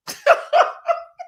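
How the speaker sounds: high, loud burst of laughter; real laugh. Real, not acted. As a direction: crack up. Laughing at her own story.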